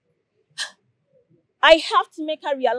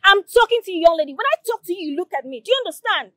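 raised, commanding scold; stern scolding; 5 semitones higher, faster than usual. Acted. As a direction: put the junior in her place. Prefect scolding junior.

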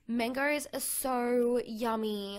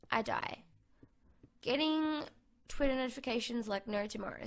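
usual speaking voice

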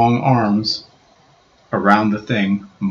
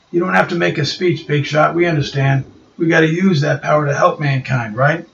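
higher tough-guy voice, mildly grudging; a bruiser giving in; gruff Ben; 7 semitones higher than usual. Acted. Ben answering Reed, lightly gruff.

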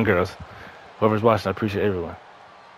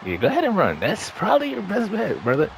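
taunting up high; high mocking taunt; 11 semitones higher than usual. Real, not acted. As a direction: tease them from above.

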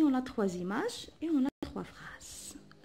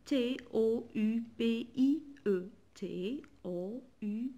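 letters spelled slowly one by one; teacher spelling a word for pupils; slower than usual. Shown as a demonstration. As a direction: spell each letter with a gap. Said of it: "Ici, on a".

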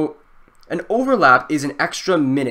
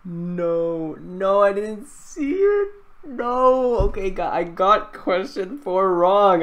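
high, slow wail of regret; dismayed whine; 5 semitones higher, much slower than usual. Real, not acted. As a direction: draw out each no in disbelief. Dismayed at missed answer.

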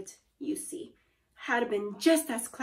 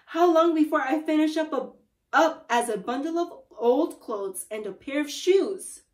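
raised, panicky question; crying out; 4 semitones higher, 5 dB louder than usual. A narrator's reading. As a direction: start high, loud and rushed, then ease slightly. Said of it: "cried Missus Twit".

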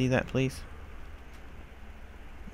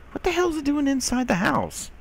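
surprised. Real, not acted. Finds spider inside house.

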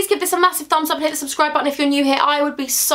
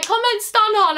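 perky catchphrase; 7 semitones higher, slower than usual. Shown as a demonstration. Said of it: "you can comment, "Stun hun".

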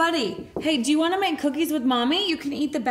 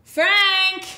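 calling out; 4 semitones higher, 7 dB louder than usual. Acted. Mom calling husband again.

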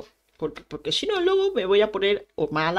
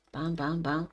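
ordinary talk, nothing marked

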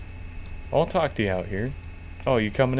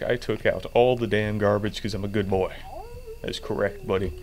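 usual speaking voice

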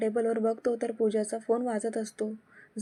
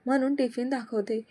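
ordinary delivery, nothing marked

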